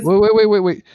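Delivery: high, loud, rapid-fire disbelief; real astonished interruption. Real, not acted. Host stops guest over writing in Excel, astonished.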